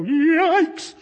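loud, high shriek of alarm; frightened yelp. Acted. Person seeing a dead loved one appear, frightened yelp. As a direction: yelp it in mock terror. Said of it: "we're now frightened".

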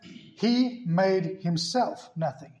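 "He made himself" drawn out word by word; slow, weighty stress on "himself"; emphatic word-by-word. Shown as a demonstration. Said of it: "Did you notice that?".

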